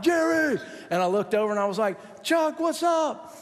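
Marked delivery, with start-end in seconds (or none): 0.0-0.6 high, drawn-out yell of a name; imitated yell. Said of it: "I heard him yell out". 2.2-3.4 high, drawn-out greeting called across the street; high calling back. Retold or imitated.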